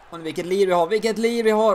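high, loud delighted shout; excited cheer. Real, not acted. Gamer cheering his team's energy. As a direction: cheer the team on.

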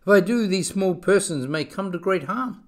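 alarmed. A narrator's reading. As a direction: think it aloud with mild alarm. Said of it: "Horton thought with alarm".

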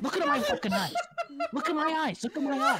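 high, loud repeated demand; shouted, over-the-top command; hammed-up furious shout. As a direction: explode as an enraged film star. Climax of acted celebrity tirade.

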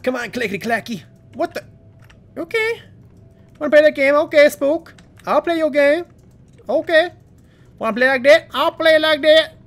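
high, loud taunts; excited taunting. Real, not acted. Taunting the monster Spook, high and loud. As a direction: dare the monster to chase.

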